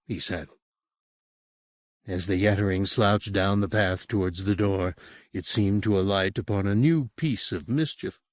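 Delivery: usual speaking voice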